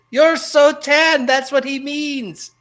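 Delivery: hammed-up squeaky waitress, drawn out; mock waitress. Waitress explains the joke, high slow mock voice. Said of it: "She goes".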